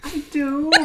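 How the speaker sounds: normal talking voice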